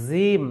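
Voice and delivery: জিম mispronounced "zeem"; a buzzing z at the start of জিম; wrong z sound. Shown as a demonstration. Teacher shows what not to say, জিম as zeem. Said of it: "তাহলে ওটা ভুল উচ্চারণ".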